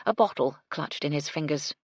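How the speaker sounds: usual speaking voice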